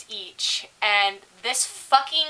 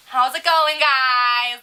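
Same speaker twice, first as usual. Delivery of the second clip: effusive greeting; 3 semitones higher, 6 dB louder, much slower than usual. Retold or imitated. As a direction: greet your favourite regulars across the room. Acts out greeting regular customers.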